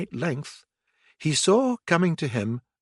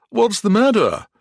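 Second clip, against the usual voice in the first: gentleman voice; 10 semitones higher, 7 dB louder than usual. A narrator's reading. Gentleman asks.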